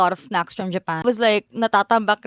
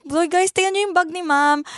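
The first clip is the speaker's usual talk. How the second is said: sales lady calling; 9 semitones higher than usual. Retold or imitated. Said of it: "calling their friends".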